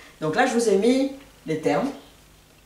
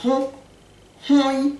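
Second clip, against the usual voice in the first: hon said high, the second time louder; stressed hon; 8 semitones higher, 4 dB louder than usual. Shown as a demonstration. A teacher explaining that hon means to grab, stressing it. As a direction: lean on hon twice to show it grips. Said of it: "prendre quelque chose".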